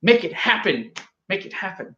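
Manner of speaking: normal talking voice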